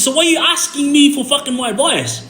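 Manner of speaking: high-pitched exasperated challenge. Retold or imitated. Confronting the friend who asked his advice, exasperated. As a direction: throw the question back at him, pitch way up.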